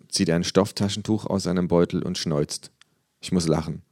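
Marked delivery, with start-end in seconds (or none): none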